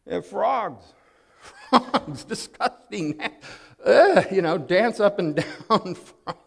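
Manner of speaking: giggling through the words; can't keep a straight face about frogs; laughing. Real, not acted. Pastor telling the congregation about frogs, laughing.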